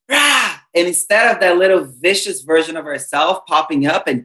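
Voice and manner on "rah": a short, high burst of "Rah!"; mock angry roar. Imitating how we flare up at Mom, a mock angry roar. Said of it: "we flare up and we're like".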